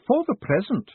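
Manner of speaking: put-on high, clipped stern old clerk; prim postmistress. A narrator's reading. Stern clerk talking down to Moist, in a prim high voice. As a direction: speak like a stern counter clerk.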